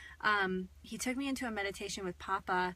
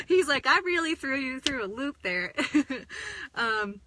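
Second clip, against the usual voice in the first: much higher and louder, through laughter; laughing; 6 semitones higher, 6 dB louder than usual. Real, not acted. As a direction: giggle through the teasing line. Laughing about the surprise.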